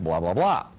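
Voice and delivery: low, tossed-off blah blah blah; dismissive blah. Real, not acted. Speaker skipping the end of the Masonic oath, dismissive and low. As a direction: wave off the rest of the oath, low and bored.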